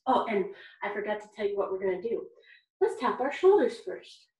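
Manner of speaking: usual speaking voice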